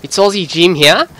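put-on Aussie accent, rising line ends; Australian accent. Gamer introducing a live com, hammed-up Australian accent. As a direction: lay on an old Aussie accent. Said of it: "old Australian accent".

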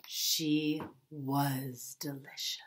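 slow hushed whisper; a slow, spooky whispered reveal; whispered aside. A narrator's reading. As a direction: drop to a slow secret whisper. Narrator hushes the dark twist.

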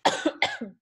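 real coughing fit breaking off speech; choked by a sudden cough. Real, not acted. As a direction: cough helplessly mid-word. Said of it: "I'm dying".

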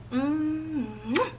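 soft, high kiss sound; blown kiss. Real, not acted. Creator signing off with a soft blown kiss. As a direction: blow the viewers a kiss.

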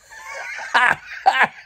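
long high-pitched cackle; high laugh. Real, not acted. Picturing the cellmate's shorts, a high cackle.

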